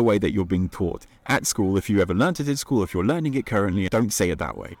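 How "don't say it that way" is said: normal talking voice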